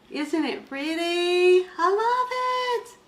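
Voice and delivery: high, slow gushing delight; slow, high-pitched cooing praise. Real, not acted. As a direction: gush over it like a new treasure. Cooing over the new item.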